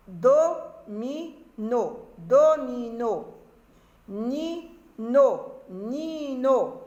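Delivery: slow reading, "domino" and "nino" broken into syllables. Shown as a demonstration. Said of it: "nous allons commencer, bien sûr, la lecture des mots".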